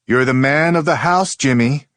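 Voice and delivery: put-on high, loud older aunt voice; aunt's voice. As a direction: voice the aunt firmly. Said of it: "he remembers his aunt telling him".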